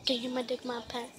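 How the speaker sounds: usual speaking voice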